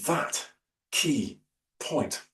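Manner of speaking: slow THAT KEY POINT, a pause between each word; slowed for emphasis. Shown as a demonstration. Speaker showing an audience how to land a point, slowed for emphasis. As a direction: spell out THAT, KEY, POINT one word at a time. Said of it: "I might slow down to spell out".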